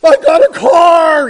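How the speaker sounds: high, very loud, slow cheering yell; thrilled shout. Retold or imitated. Friend films himself unwrapping his own car, shouting. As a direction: yell it like you can't believe your luck. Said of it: "and he's like".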